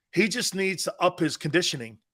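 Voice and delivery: usual speaking voice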